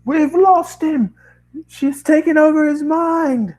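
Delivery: high, slow, loud panicked yell; scientist yelling. Acted. Random scientist yells out to the crowd, panicked. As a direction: cry out that he's been taken over. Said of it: "one random scientist yells out".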